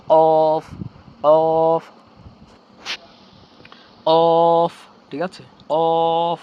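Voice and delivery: অফ said heavy and loud from the back of the tongue. Shown as a demonstration. Teacher drilling the heavy qaf for viewers, loud. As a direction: boom out অফ, thick and heavy. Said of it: "মোটা করে পড়ব".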